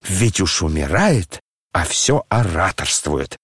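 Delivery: ordinary delivery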